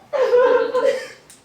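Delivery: a sudden high cackle of laughter; loud laugh. Acted. Sudden loud laugh after joke.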